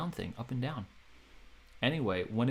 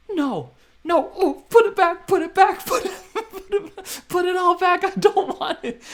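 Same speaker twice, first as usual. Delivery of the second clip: very high, very loud screaming; panicked screaming; 13 dB louder than usual. Retold or imitated. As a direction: scream to undo it all. Horrified after pump-and-dump news.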